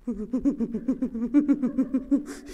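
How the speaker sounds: stuttering shivery noise; imitated shaking. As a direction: make a juddering noise of trembling nerves. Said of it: "shaking really bad".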